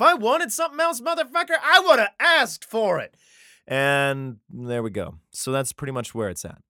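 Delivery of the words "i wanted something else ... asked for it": high, loud mock outrage; angry God. God scolding over an unrequested house, angry yelling. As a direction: yell it indignantly, drawing out the ending.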